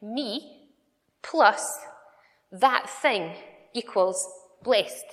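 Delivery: slow, each term set apart; spelled-out sum. Shown as a demonstration. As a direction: lay out the sum word by word. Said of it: "some sort of sum".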